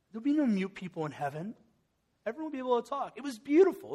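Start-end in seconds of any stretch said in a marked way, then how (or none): 2.3-3.9 swell of feeling. Real, not acted. Pastor moved by heaven image.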